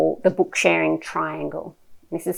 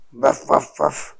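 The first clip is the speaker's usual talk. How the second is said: low little woofs; dog barks; 4 semitones lower, slower than usual. Shown as a demonstration. Reading about a dog to a toddler, low barks. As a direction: woof like a friendly dog.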